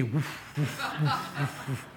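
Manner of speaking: quiet breathy woof sounds with a laugh; soft whooshing woof. Retold or imitated. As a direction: imitate the club flying off, softly. Golf club flying sound effect.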